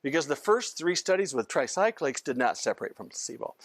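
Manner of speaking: ordinary talk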